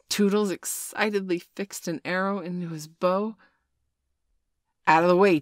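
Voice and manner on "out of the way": loud warning shout; boy shouts to the fairy before firing. A narrator's reading. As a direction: bark the warning. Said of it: "he shouted".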